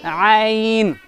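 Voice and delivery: आइन from the middle throat; Arabic ain. Shown as a demonstration. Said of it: "आइन कैसे निकलेंगे?".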